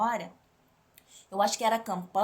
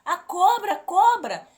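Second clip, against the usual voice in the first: high, loud frantic cry for help; panicked scream; 9 semitones higher, 8 dB louder than usual. Retold or imitated. Woman screaming to her brother about the snake, terrified high yell. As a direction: shriek it out in terror at the snake. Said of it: "gritei pelo meu irmão".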